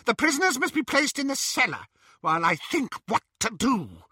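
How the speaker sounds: high imperious witch; commanding witch. Acted. Bellatrix to Narcissa, high imperious command. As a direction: order her sister coldly.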